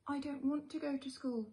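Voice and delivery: be the small raccoon who won't go; whiny little boy. Acted. Little Chester begging his mother, high and whiny. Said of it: "he told his mother".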